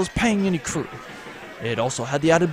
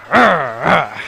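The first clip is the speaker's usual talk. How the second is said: mock tiger growl; 6 dB louder than usual. Pirate mimics tiger growl.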